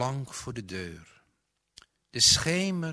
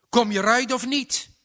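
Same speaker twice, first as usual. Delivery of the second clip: fast, loud and much higher in anger; hees van drift; 12 semitones higher, 9 dB louder, faster than usual. A narrator's reading. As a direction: spit it out fast in a fury. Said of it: "hees van drift". (snel, luid en woedend)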